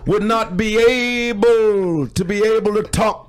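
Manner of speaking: high, loud, slow oratory with stretched words; booming parody of a historic speech; mock civil-rights orator. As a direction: draw the words out like a grand speechmaker. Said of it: "He's like this".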